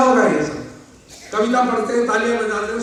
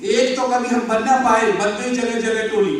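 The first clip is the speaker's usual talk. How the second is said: raised, stretched-out poetry recital; declaimed verse; 4 semitones higher, 6 dB louder than usual. Acted. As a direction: declaim it to the hall in metre.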